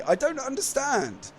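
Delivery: ordinary delivery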